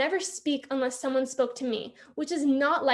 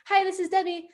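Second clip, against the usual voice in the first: imitating Mom's nice, higher phone voice; cheery phone greeting; 5 semitones higher than usual. Mom answering the teacher's call, cheery and nice. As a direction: answer the phone brightly and politely, pitched up. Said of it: "that whole cheery voice".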